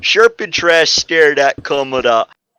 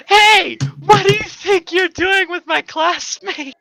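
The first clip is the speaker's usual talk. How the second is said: put-on high-pitched shouted girl voice; girl's shout. Girl shouting at the boy holding her classmates, high shout. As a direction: yell it high and sharp at the boy. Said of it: "The girl shouted".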